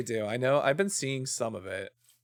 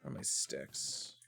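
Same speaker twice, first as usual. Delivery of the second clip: whisper; 9 semitones lower, 9 dB quieter than usual. Real, not acted. Muttering to self while searching.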